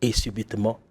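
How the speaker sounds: story narration. Acted.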